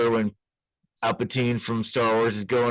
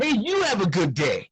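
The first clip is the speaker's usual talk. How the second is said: higher mock-chipper farewell; cheery clerk; 9 semitones higher than usual. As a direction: sing out a forced friendly goodbye.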